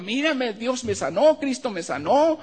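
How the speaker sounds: high, loud exclaiming healed; joyful shout. Retold or imitated. Healed woman to her father, joyful high cry. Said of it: "sale y le dice a su papá".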